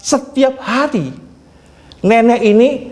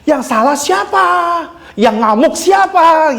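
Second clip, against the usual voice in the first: mock angry retort, pitched high; menirukan orang ngamuk; 8 semitones higher than usual. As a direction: throw back indignant questions, playfully retold. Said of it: "lebih ngamuk lagi". (tiruan bantahan marah bernada tinggi)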